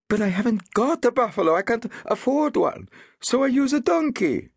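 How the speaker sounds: high, loud, plaintive villager's voice; protesting villager. A narrator's reading. Villager answering the constable, high and plaintive. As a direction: argue it, poor and desperate.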